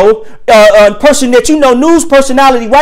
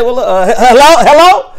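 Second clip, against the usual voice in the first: mock phone answer. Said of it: "I said".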